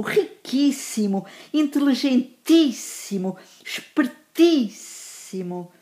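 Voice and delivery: cárcere, alínea, fotográfico with the third-last syllable held long; emphatic word list, stretched stress in paupérrimo, pobríssimo, espertíssimo; stress on antepenultimate syllable. Shown as a demonstration. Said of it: "na antepenúltima sílaba".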